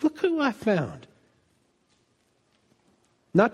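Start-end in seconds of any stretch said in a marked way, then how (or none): 0.0-1.1 softer, slow, hushed and loving; Jesus speaking tenderly about you. Retold or imitated. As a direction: say it gently, as if to the one found. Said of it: "Jesus feels that way about you".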